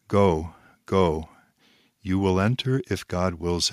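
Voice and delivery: "Go, go. You will enter if God wills it" with every syllable weighted and spaced apart; stressing each syllable. Retold or imitated. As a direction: spell it out slowly, syllable by syllable, with firm authority. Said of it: "stressing each syllable".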